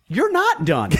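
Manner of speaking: high-pitched indignant retort; retold indignation. Bystander refusing to let the attacker quit, indignant.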